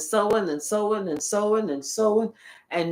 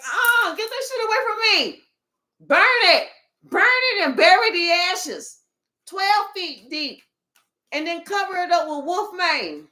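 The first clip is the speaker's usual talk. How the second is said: mock disgusted refusal; 8 semitones higher than usual. Mocking someone who refuses to touch it, horrified. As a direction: shriek it away like it burns.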